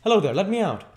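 loud, high, urgent call; crying out. Acted. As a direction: shout to the coachman as an impatient doctor. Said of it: "cried the doctor".

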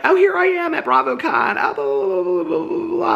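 high, showy mock brag; bragging voice. Making viewers jealous at BravoCon, a high showy gloat. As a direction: gloat like you're living it up. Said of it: "I will be like".

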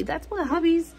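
ordinary delivery

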